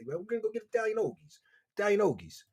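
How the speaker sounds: Italian hoagies in a thick South Philly Italian accent, hoagie with a broad open o; heavy South Philly Italian accent. Shown as a demonstration. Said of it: "some guys in the neighborhood".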